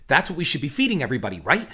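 very fast quick-cut delivery; rapid-fire patter. Shown as a demonstration. Podcaster demoing quick-cut vlog talk, rapid-fire. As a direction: spit it out like a jump-cut vlog. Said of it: "I should do my entire podcast talking this way".

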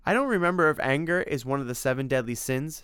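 a hint of exasperated reproach; Tim, scolding. Tim tells Simon he's getting out of control, mildly scolding.

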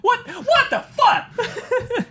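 very high, drawn-out comic shriek; Trailer Park Boys. Acted. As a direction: squeal it slowly in mock disbelief. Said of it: "Oh, Trailer Park Boys".

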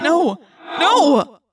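loud, high, drawn-out cries of protest; anguished refusal. A narrator's reading. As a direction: cry out in desperate denial. Refusing friend's request to die.

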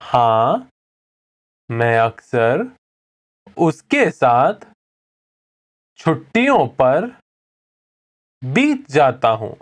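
separated phrases with long pauses; slow repetition. Shown as a demonstration. Teacher says the answer for repeating along, slowly and spaced out. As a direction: leave room after every phrase for the learner. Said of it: "I'll say it again slowly".

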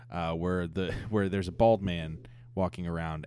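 usual speaking voice

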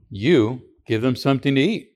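"You" set apart by a pause, then the rest; stress on YOU. Shown as a demonstration. Preacher shows how Jesus told the disciples, stress on "You". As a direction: lean on the word "You" and pause after it. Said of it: "There is that emphasis on the word you".

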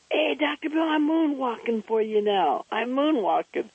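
put-on high, bright little-boy call while dancing; proud kid showing off his moonwalk; excited child voice. Boy calls to Doctor Bill mid-dance, high excited child voice.

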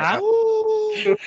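high wolf howl. Acted. Werewolves of London.

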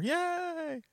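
high delighted yay; excited yay. Real, not acted. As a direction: squeal it with joy. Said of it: "get to go to Disney World".

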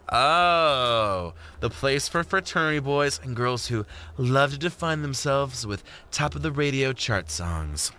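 Jordan, knowing tease. Acted. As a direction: tease him with mock recognition.

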